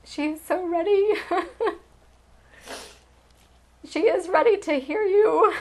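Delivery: tearful, laughing through the emotion; getting emotional. Real, not acted. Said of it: "now I'm starting to get emotional".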